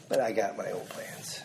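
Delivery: first half normal, then whisper; whispered aside. Retold or imitated. Preacher voicing a proud listener, ending in a whisper. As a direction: drop to a whisper on the second half.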